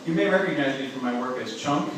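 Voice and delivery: ordinary talk, nothing marked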